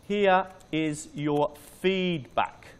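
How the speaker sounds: slow, word by word; typing aloud. Shown as a demonstration. Teacher typing email to student, slow and separated. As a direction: drag the words out as you type.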